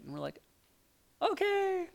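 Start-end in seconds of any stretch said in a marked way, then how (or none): 1.2-1.9 put-on high squeaky kid's okay. Boys answering their dad about summer, high squeak. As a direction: squeak it out like a little boy.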